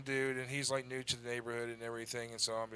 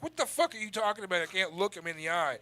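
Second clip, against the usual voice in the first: louder, higher, quickened disbelief; retold outburst of indignant confusion; incredulous retort; 5 semitones higher, 6 dB louder than usual. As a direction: throw it back in baffled protest. Retells his baffled retort to friend.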